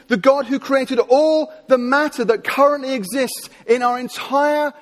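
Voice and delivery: slow, rising preacher build, each God stressed; a hint of a revival preacher; building sermon cadence.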